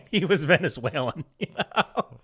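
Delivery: chuckling through the words; laughing. Real, not acted. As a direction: laugh while telling it. Laughing at his own story.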